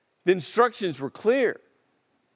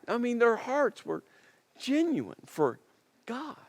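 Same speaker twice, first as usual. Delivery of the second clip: placating; 6 semitones higher, 4 dB quieter than usual. Retold or imitated. Saul's soothing excuse, softer.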